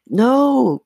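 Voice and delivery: high, loud wail of refusal; protesting cry. A narrator's reading. Chris hears the dummies are going, protesting cry. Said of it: "Chris cried".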